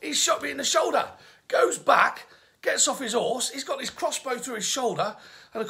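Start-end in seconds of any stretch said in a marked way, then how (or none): 0.0-1.1 higher, louder outraged cry of a shot king; mock-outraged king yelping; wounded king. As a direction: play Richard indignant at being shot. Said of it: "Richard, okay, is like".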